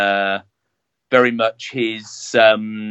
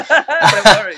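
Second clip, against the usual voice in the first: laughing; 10 semitones higher, 7 dB louder than usual. Real, not acted. Laughs at host's pronunciation.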